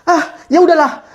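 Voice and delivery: ordinary talk, nothing marked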